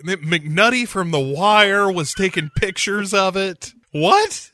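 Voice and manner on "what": surprised what. Real, not acted.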